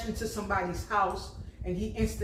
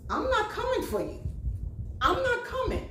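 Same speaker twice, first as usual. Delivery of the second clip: much higher, firm, half-laughing refusal; rising high mock-stern refusal; emphatic warning; 13 semitones higher than usual. Real, not acted. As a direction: say no with a laugh, pitched way up. Mom warning kids, emphatic.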